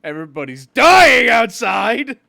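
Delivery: loud, high, slow yelled retort; mock shout. Friend shouting a joke retort at the customer. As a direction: shout it over the counter, hammed-up. Shouted quip in Subway story.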